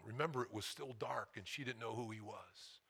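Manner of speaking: usual speaking voice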